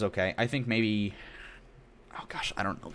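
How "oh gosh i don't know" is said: whispered aside. Real, not acted. Muttering while deciding on window.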